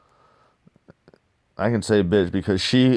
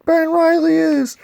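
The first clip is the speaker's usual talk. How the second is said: much higher, louder falsetto woman's voice; mock woman voice; 6 dB louder than usual. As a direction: squeak it out like an outraged woman. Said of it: "She's like".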